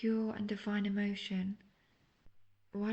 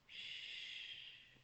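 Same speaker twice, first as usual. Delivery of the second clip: soft shush; 16 dB quieter than usual. Acted. Soothing captive before sleep.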